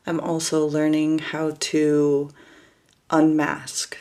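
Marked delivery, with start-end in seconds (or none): none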